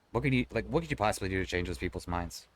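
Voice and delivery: ordinary talk, nothing marked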